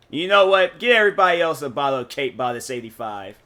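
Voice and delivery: loud, expansive mock big spender; play-order grandly for the whole restaurant. Freshly paid customer treating everybody, loud and carrying on. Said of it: "that's why I'm talking like this".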